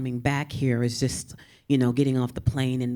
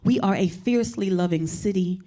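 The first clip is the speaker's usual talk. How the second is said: higher, measured spoken-word reading; poet voice; 5 semitones higher than usual. Shown as a demonstration. Reading her poem about San Antonio in her poet voice. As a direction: lift each line like a prayer. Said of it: "I will switch into poet voice".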